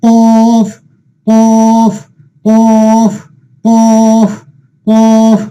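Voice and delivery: কফ held long on one steady note, thick opening consonant; heavy drawn-out pronunciation. Shown as a demonstration. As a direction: chant each কফ slowly and heavily. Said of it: "একটু মোটা করতে হয়".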